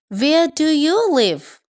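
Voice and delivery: usual speaking voice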